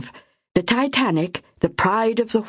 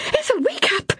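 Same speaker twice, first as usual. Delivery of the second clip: even higher, rising, desperate; more frantic cry. Acted. Myra shaking sleeping Ethel, frantic rising cry. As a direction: she still won't wake, push harder. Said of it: "Myra cried".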